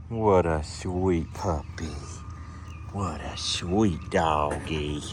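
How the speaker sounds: low, slow cooing; talking to a dog. Real, not acted.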